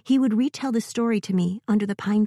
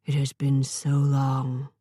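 slow, distant giant robot; 5 semitones lower, slower than usual. Acted. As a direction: drag each word like an old machine.

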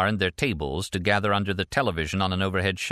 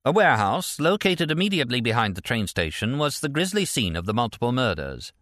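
higher, projected newsreader delivery; formal bulletin voice, pitched up; news anchor read; 5 semitones higher than usual. A narrator's reading. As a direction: report it like a TV anchor. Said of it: "the male anchor said".

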